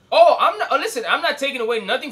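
loud, high startled backpedal; sudden exclamation. Real, not acted. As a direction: blurt it out as you catch yourself.

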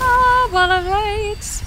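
surprised relief. Real, not acted. Surprised the fight ends.

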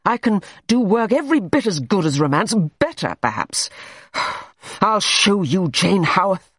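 mild angry boast. A narrator's reading. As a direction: prove her wrong, hitting the I. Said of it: "he said angrily".

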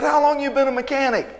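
high, disbelieving question; can't believe what he's hearing; incredulous. Retold or imitated. Retelling questioning the young mechanic, incredulous and high.

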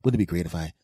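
ordinary talk, nothing marked

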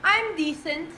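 normal talking voice

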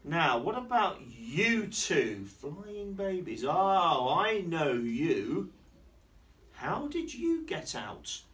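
slow, loud, swooping delivery; exaggerated storybook surprise, words drawn out; story character addressing babies. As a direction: playfully question and gather up little ones.

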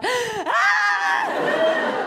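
very high laughing shriek of horror; shocked shriek. Retold or imitated. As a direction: squeal at the sight of the arm. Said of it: "You're like, gasps".

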